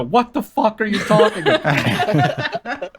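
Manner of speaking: laughing quote. Retold or imitated. Quoting the chat while laughing.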